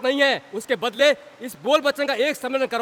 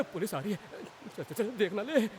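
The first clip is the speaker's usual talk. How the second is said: lower, hushed getaway mutter; hushed alarm; 4 semitones lower, 12 dB quieter than usual. Acted. Man warning companion that police are coming, hushed alarm. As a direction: drop the voice and slip away.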